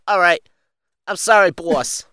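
high, loud annoying underling; rookie mobster apology. Acted. Rookie apologizing to the mafia boss, loud and high.

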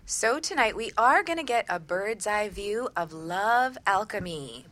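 ordinary delivery

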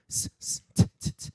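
unvoiced S and T hissed and ticked into a mic; sibilant sounds. Shown as a demonstration. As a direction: spit out bare S and T sounds, no voice. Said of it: "you're like".

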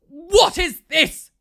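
slow, loud, high mock outrage; shouting. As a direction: imitate an irate plumber bellowing. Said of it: "Like, shouting".

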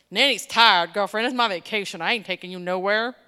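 loud, emphatic mock telling-off; sassy refusal. Grandmother refusing granddaughter on vacation, loud sassy refusal. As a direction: lay down the law, playfully loud.

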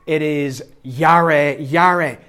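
"yare" said slowly, yah-reh; Hebrew word. Shown as a demonstration. Preacher quoting Hebrew for the congregation, slow. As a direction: draw out "yare" twice. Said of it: "Same word twice".

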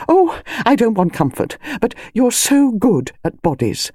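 high, gushing, agitated phone voice; agitated Mrs Bantry, excitable. A narrator's reading. Friend describing her argument over the body, agitated and high. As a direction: pour it out to a friend, pitch swooping high.

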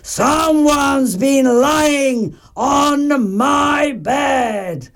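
slow, drawn-out bear roar; big storybook bear's roar; roaring Father Bear. A narrator's reading. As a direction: bellow with indignation, stretching every word. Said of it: "and roared".

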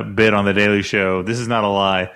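usual speaking voice

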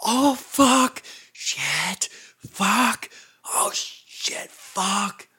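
strained, breathy stage whisper of curses; mock frantic whispering. Friend imitating drunk man pacing naked, frantic whisper. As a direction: curse under your breath in imitated panic. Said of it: "really whispering it".

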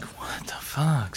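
slow, hushed disbelief; quietly bewildered guard; baffled mutter. Pretend. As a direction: mutter it slowly under your breath.

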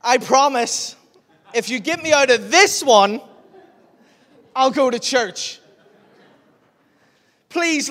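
high, loud pleading to the Lord; desperate prayer. Retold or imitated. Stranded kayaker begging God out loud to be saved. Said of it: "I went".

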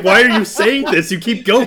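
high, loud, through laughter; laughing. Real, not acted. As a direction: laugh helplessly as you protest. Teasing the impression while laughing.

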